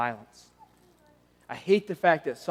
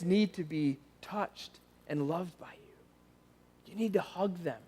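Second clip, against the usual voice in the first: whispered, much softer and tender; hushed heartfelt plea; 11 dB quieter, slower than usual. Acted. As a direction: lean in and whisper it to the fathers.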